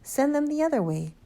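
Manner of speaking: high-pitched, exasperated shout; bellowed order. A narrator's reading. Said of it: "Mister Hazel bellowed".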